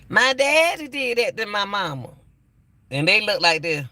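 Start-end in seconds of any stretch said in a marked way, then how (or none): 0.1-2.1 put-on higher childlike retelling; little girl's voice. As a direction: lightly voice the child. Child quoted telling on daddy.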